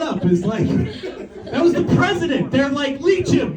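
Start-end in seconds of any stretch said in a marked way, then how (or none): 3.0-3.6 high exclaimed command; comically urgent doctor, pitched up; mock medical order. Acted doctors' absurd order.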